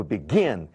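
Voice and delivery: "begin" stressed on GIN; be-GIN, first syllable light; stress on last syllable. Shown as a demonstration. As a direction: lean hard on the final syllable of begin. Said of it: "Sílaba tônica é a última".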